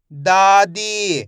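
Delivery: दादी very slow, both vowels held; a loud long दा, then held दी in दादी; stretched pronunciation. Shown as a demonstration. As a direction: blend दादी slowly for learners. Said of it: "direct मात्रा का उच्चारण करके पढ़ेंगे".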